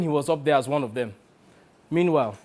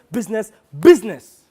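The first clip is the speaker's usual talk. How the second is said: "business, business" building much louder; mocking repetition; 4 semitones higher, 12 dB louder than usual. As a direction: hammer "business" harder on each repeat. Mocks agent's favourite word.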